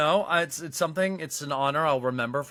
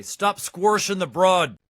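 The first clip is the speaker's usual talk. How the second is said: put-on slow, louder Pittsburgh accent, squirshing for squashing; 5 semitones higher, 6 dB louder, slower than usual. Retelling a friend's Pittsburgh accent, slow and loud. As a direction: lean into a thick Pittsburgh accent on squirshing the broad. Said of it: "way to describe a Pittsburgh accent was he would say".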